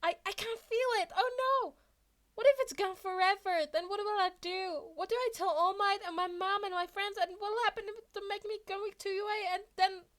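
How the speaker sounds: high, fast panicked cries; panicking. A narrator's reading. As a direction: spiral into tearful panic. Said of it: "Panic floods his system".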